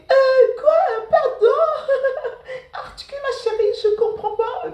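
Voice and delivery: high, loud imitation of a scornful student; mocking classmate. Classmate pretends not to understand her Senegalese accent, loud mocking imitation. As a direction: laugh through a loud, high-pitched mock incomprehension.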